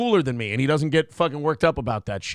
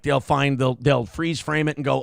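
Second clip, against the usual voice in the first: ordinary talk, nothing marked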